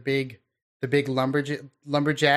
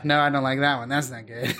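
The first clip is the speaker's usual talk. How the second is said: normal talking voice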